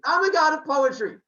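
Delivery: high, loud acted brag; eager boast. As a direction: proclaim it instantly to impress. Retells Apollo boasting to impress.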